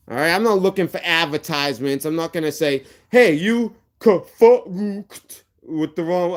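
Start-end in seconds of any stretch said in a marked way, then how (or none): 3.1-6.3 higher, drawn-out mocking impression; stretched-out sneering imitation; mocking the dear leader. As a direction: mimic a rival's speech, slow and exaggerated. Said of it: "I don't talk like that, like your dear leader".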